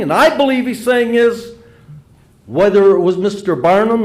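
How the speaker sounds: normal talking voice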